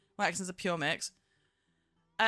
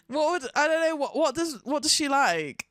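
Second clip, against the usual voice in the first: usual speaking voice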